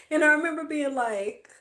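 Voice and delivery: ordinary talk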